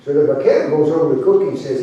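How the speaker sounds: plain reading voice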